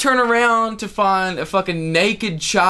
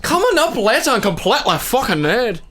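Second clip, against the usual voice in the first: higher-pitched mock Scottish announcer; 6 semitones higher than usual. Scottish Playboy Channel promo, hammed-up accent. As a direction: sell the show in a broad joke Scottish accent. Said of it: "Playboy Channel for fucking Scottish dudes".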